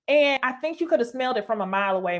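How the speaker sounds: usual speaking voice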